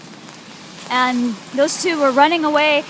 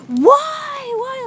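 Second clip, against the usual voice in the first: long, high imitated wail of agony; retold scream; 11 semitones higher, 4 dB louder than usual. Acting out her tearful sister in agony, high retold wail. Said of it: "like that, and screaming".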